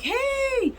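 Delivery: high, drawn-out hey; greeting call. Retold or imitated. Voicing Nikita's greeting to her.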